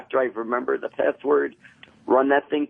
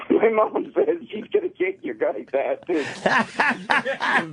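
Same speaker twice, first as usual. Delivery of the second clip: high excited laughing talk; 11 semitones higher, much slower than usual. Real, not acted. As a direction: giggle through it, worked up. Laughing after acting it out.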